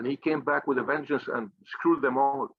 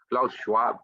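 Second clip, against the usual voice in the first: ordinary delivery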